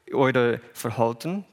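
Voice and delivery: usual speaking voice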